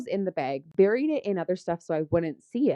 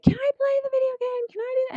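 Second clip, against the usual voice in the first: put-on much higher, whining child's plea; whiny kid; faster than usual. Imitating her youngest begging for the video game, high and whiny. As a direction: beg like a pestering little kid, almost crying. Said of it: "my youngest, Casey, starts".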